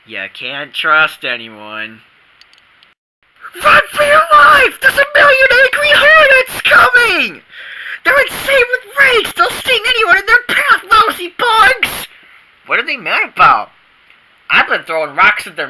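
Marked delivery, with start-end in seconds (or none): 3.6-12.1 high, loud panicked yelling; panicked warning. Acted. Boy fleeing hornets yells warning to everyone. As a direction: scream the alarm while running.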